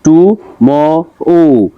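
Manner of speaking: টুমরো slowly split as টু, ম, রো; three drawn-out beats on টুমরো; syllable by syllable. Shown as a demonstration. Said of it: "তিনটা দেওয়া আছে".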